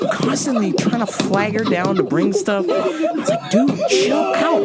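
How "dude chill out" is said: high, slow, stretched-out plea; acted fed-up appeal; mock exasperation. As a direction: drag it out in exasperation. Acted telling dad to calm down.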